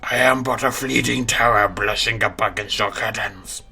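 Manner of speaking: slow, loud eldritch monster voice; Cthulhu voice. Acted. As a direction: boom it out like an ancient horror. Cthulhu voice introduced.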